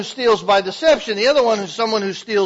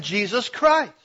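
usual speaking voice

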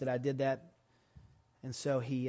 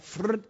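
belt whip sound; 7 semitones higher, 4 dB louder than usual. Retold or imitated. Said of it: "The sound I can still hear".

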